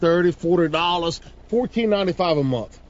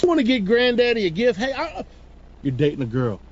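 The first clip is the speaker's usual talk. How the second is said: ordinary talk, nothing marked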